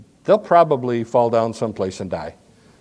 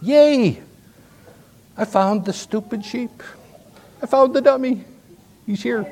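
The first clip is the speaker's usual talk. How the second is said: much higher, gleeful cries of joy; rejoicing; 10 semitones higher than usual. Retold or imitated. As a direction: cheer like you found a lost treasure.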